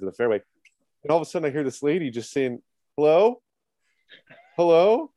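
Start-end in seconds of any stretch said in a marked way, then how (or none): 3.0-5.1 questioning hello, raised and higher, rising at the end; retold calling out. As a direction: imitate a lady calling out from her backyard.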